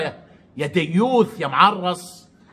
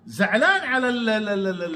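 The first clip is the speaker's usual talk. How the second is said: ordinary talk, nothing marked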